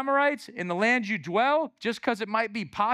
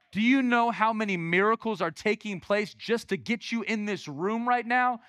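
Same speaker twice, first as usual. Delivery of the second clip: ordinary delivery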